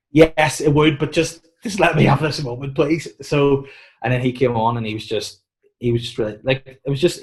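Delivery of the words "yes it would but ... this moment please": high, loud mock pleading; exasperated begging, pitched up. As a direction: plead loudly for just one moment. Acting out his own pleading reply.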